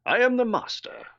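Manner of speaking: high, posh villain impression; Roger Delgado. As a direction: declare it as a grand British villain. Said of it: "one of his very first lines".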